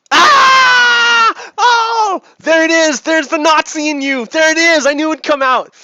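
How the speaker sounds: high, loud shouted gotcha; triumphant outcry. Retold or imitated. Catching the man revealing himself, shouting high and loud. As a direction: cry out like you've caught him out. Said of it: "And I'm like".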